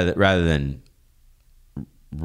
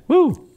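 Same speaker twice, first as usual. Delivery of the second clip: excited whoop; 7 dB louder than usual. Real, not acted. Cheering at the end of the episode.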